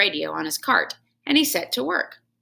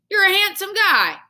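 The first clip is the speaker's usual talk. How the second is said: much higher, louder compliment; jolly janitor; 12 semitones higher, 6 dB louder than usual. Acted. Janitor joking with the hamster, hearty. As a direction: tease with a boastful grin.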